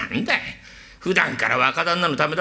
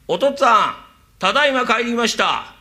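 the son's voice put on, a touch louder and higher; mimicking the son; 6 semitones higher, 3 dB louder than usual. Servant imitating the son to fool the father. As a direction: pass for the son calling home. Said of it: "一声で渡すから".

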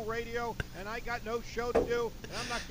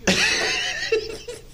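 very high, much louder, slow laughing scream; exasperated laugh; 12 dB louder than usual. Real, not acted. Host venting about unpaid work, high loud laughing scream. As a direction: break into an incredulous laugh mid-rant. Rant breaks into strained laugh.

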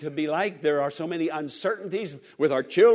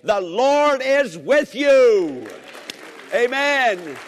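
slow, loud and higher, proclaiming; preaching at full force; 6 semitones higher, 6 dB louder, much slower than usual. Acted. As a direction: thunder it out, stretching every word. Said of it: "I say that in faith".

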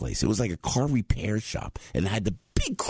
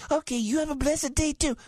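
much higher, sugary goodbye; sweet driver voice. Retold or imitated. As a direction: wish a blessed day in a high sweet voice. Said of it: "the driver goes".

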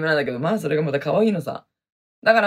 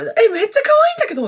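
excited squeal; 4 dB louder, much faster than usual. Real, not acted. Gushing over a viewer's message.

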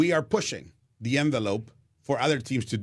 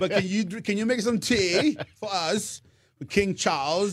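mock posh British; 8 semitones higher than usual. Teasing guest about British tea.

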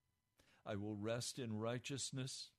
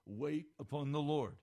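loud, stern command; stern divine command, much louder; 6 semitones higher, 9 dB louder than usual. Retold or imitated. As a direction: thunder it like a firm father. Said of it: "Very sternly".

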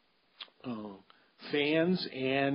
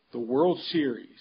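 ordinary talk, nothing marked

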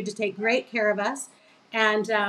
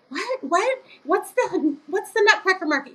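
imitating her own baffled self, pitched high; retold bewildered whats, rising high; mock clueless confusion; 9 semitones higher than usual. Her younger self baffled by Ray's outburst, high mock confusion. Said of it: "And I'm like".

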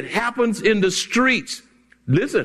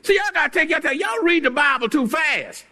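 normal talking voice

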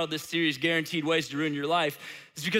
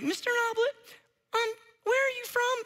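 very high, put-on kid falsetto; imitated fifth grader. Student asks where the substitute is from, imitated fifth grader. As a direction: ask like a curious little kid, way up high. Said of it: "my best imitation of a fifth grader".

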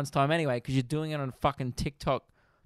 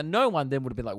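ordinary talk, nothing marked

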